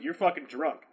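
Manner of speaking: usual speaking voice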